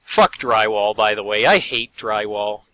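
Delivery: usual speaking voice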